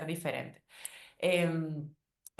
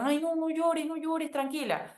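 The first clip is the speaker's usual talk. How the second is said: lightly voiced soothing mom, pitched well up; hushing someone crying, a touch sing-song and high; mock soothing; 9 semitones higher than usual. Someone hushing a crying daughter: high mock-soothing coo.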